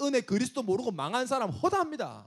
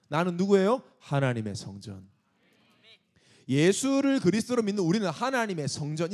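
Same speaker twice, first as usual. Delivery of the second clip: ordinary talk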